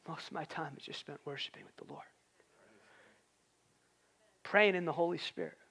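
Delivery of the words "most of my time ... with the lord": near-whisper, low and very soft; hushed reverence. Real, not acted. Hushed confession of private worship.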